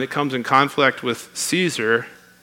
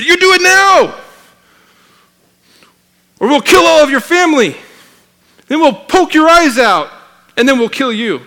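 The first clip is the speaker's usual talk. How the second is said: a bad Chinese accent, much higher and shouted; 13 semitones higher, 10 dB louder than usual. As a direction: threaten in a bad Chinese accent, loud and high. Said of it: "my bad Chinese accent".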